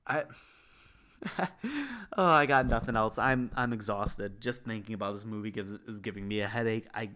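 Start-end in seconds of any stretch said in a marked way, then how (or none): 1.2-2.1 a burst of high exasperated laughter; tired helpless giggle. Real, not acted. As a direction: laugh it off, giving up. Laughs at own rant.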